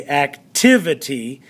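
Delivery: "activity" slowed, weight on the second syllable; deliberate ac-TIV-ity for learners; stress on TIV. Shown as a demonstration. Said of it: "because that's where the".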